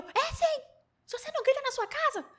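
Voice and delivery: very high insistent retort; mock yell. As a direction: fire it back, high and pushy. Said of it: "E eu". (retruca gritando, bem aguda)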